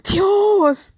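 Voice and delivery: high, drawn-out call to God; acting out a loud cry to heaven; imitated cry out. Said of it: "gritó y le dijo".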